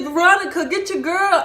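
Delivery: high, loud imitated yell; acting out a man's frantic call; calling out for help. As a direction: shout to a friend for backup. Man yells to her friend for help.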